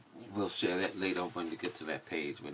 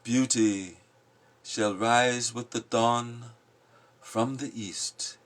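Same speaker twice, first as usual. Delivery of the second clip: louder, declaiming the lines; forceful poem reading; 6 semitones higher, 9 dB louder, much slower than usual. Acted. Giving voice to the passionate in the poem, loud declaiming. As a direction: proclaim it like a storm. Watchmen speak.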